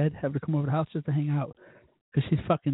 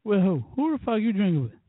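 jealous demand; 5 semitones higher than usual. Real, not acted.

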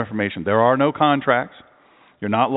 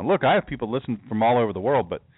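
ordinary delivery

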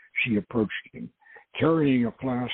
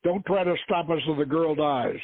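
shouted threat; 6 semitones higher than usual. A narrator's reading. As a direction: call out a threat to pursuers. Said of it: "Khalil shouted".

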